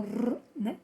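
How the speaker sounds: a soft tapped r, the sound inside Laranja; teacher shows the letter's sound. Shown as a demonstration. Teacher isolates the r sound for children, soft tap. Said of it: "o R teve esse som".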